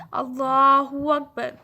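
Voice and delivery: اللہ اکبر drawn out slowly and loudly, with the doubled l held; slow recited takbir. Shown as a demonstration. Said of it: "میرے ساتھ پڑھیں".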